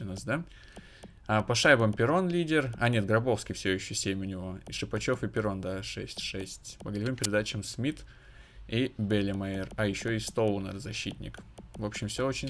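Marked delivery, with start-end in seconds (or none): none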